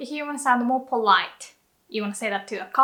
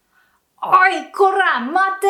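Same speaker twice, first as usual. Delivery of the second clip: loud, much higher おい、こら、待て; threatening yell; 7 semitones higher, 7 dB louder than usual. Shown as a demonstration. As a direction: roar it like a furious thug. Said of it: "sound more threatening".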